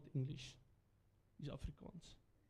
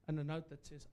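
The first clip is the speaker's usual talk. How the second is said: normal talking voice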